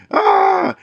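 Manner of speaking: sudden high shriek of terror; high panicked yelp; terrified scream. Retold or imitated. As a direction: scream as if drowning in blood. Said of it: "he's terrified and he's like".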